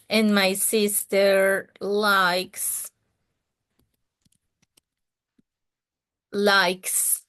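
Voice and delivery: and my sister likes, slowed right down with likes drawn out; slow model sentence. Shown as a demonstration. Teacher modelling an example sentence for the class, slowly. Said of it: "el ejemplo".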